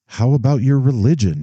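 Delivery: blurted out. Acted. Flustered father blurting to his daughter, sudden and high. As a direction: let it burst out before he can stop. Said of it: "he blurted out".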